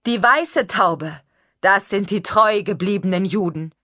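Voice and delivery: a stranger prophesying loud and high to the crowd; high preaching voice. Acted. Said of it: "eine hohe Stimme".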